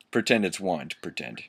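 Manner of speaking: normal talking voice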